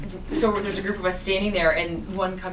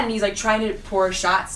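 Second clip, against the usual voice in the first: ordinary talk, nothing marked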